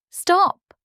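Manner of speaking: high, loud startled cry of "Stop!"; gasping cry to halt him; sudden shout. Acted. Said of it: "Olga gasped".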